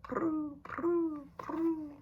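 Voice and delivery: high cooing pigeon call, prue prue prue. Retold or imitated. Reading to a child, cooing like a pigeon. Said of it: "That's their little sound they make".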